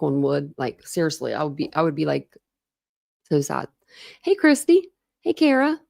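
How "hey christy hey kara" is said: bright greeting. Real, not acted. Greeting chat viewers cheerfully.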